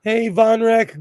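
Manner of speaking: usual speaking voice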